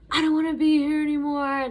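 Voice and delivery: wailing that she wants out; retold despair. Retold crying breakdown at ER.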